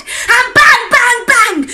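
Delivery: loud. A narrator's reading. Gunshots in the story.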